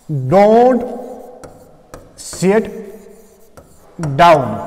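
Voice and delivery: each word set apart by long pauses, falling on down; slow deliberate reading. Shown as a demonstration. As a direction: read don't, सिट, down slowly with a pause after each. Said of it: "फिर मैंने यहां कामा किया है".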